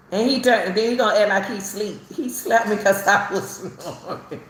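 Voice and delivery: ordinary talk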